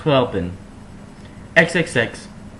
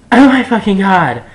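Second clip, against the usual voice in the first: much higher and louder, gushing; gushing fangirl squeal; 7 semitones higher, 6 dB louder than usual. Acted. Ebony gushing after Joel's song, high excited cry. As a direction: blurt it like a starstruck fan.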